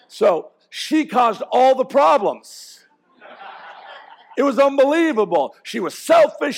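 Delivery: loud, high, hammed-up blame of his wife; mock accusation. Pastor jokingly blaming his wife Chris for their fight, loud and high. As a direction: pile it all on her in mock outrage.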